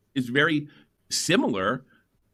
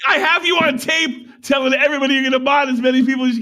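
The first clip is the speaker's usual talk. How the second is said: high, loud, outraged yell; shouted accusation; 12 semitones higher, 5 dB louder than usual. Real, not acted. Host calling out the rogue mod, a high outraged shout. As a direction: call him out at the top of your voice.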